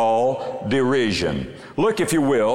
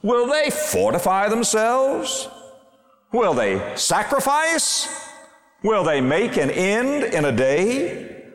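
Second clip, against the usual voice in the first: biting sarcastic scorn; slower than usual. Acted. Sanballat mocks the builders before his army, with withering scorn. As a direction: sneer each question slowly, withering and mocking. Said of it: "Can you hear the sarcasm? Can you hear the biting, withering scorn".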